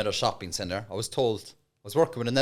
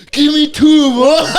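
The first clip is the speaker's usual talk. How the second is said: gang leader demand; 15 dB louder, slower than usual. Retold or imitated.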